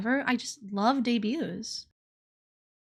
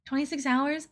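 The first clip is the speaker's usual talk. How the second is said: normal talking voice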